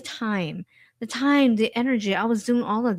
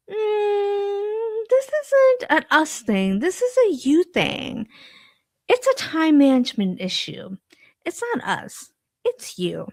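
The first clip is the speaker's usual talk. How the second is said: lightly voiced higher-pitched boss passing the blame; dismissive boss; 11 semitones higher than usual. Boss tells overloaded employee it's a time management issue, mock dismissive. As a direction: imitate a boss coolly handing back the problem. Boss's verdict after doing math in head.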